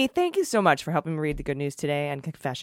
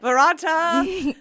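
drawn-out, high mock spell; slow, dramatic incantation; 11 semitones higher, 5 dB louder, much slower than usual. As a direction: intone it slowly like a spell. Movie incantation quote.

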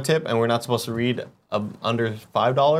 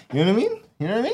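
high rising catchphrase; Kevin; 9 semitones higher, much faster than usual. Acted. Explaining a colleague's habit, in his high rising voice. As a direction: toss it out high, as a question. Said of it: "followed by".

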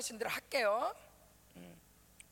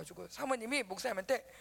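ordinary talk, nothing marked

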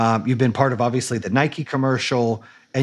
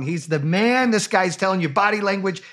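ordinary talk

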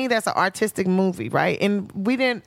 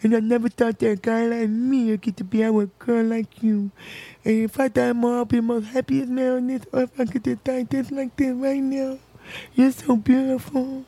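a hint of a tender, earnest man; lightly voiced date; 3 semitones higher than usual. Date holding her hand, confessing love, lightly voiced tenderness. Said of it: "he goes".

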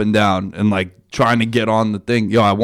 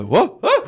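mock fall yelp; 12 semitones higher than usual.

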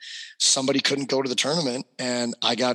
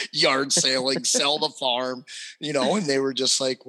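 ordinary talk, nothing marked

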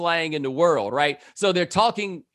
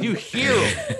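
higher, slow, stretched L.A. vowels; mock L.A. accent; 10 semitones higher, slower than usual. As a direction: stretch it out like an L.A. dude. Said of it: "It may not be a good L A accent".

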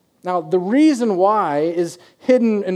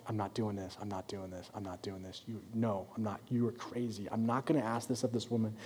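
hushed, much softer and lower inner refusal; 6 semitones lower, 16 dB quieter than usual. Pretend. Elijah refusing God inside his head, hushed and low. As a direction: mutter the refusal to yourself. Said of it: "in Elijah's head and in his heart".

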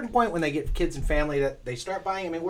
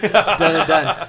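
laughing; 9 dB louder, much slower than usual. Real, not acted. Laughing agreement.

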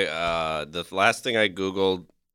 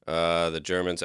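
normal talking voice

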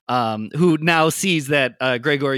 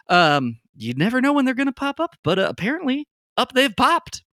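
much higher, fast chatty woman's voice; chirpy Lily; 11 semitones higher than usual. Acted. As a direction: gush the news brightly and quickly. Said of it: "Lily chimes in and says".

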